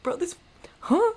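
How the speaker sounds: high-pitched confused "huh"; baffled disbelief. Retold or imitated. Manager doubting the caller's orders, acted-out bafflement. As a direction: break off, then squeak in bewilderment.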